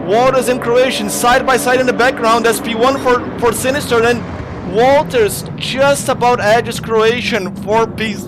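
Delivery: high, loud last-lap call; shouting commentary. Real, not acted. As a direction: yell the finish as if the crowd is on its feet. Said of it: "hear me shouting like this".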